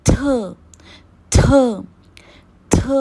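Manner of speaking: थ with a sharp breath of air from the teeth; airy aspirated थ. Shown as a demonstration. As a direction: model थ so air escapes. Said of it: "थ में भी ... air".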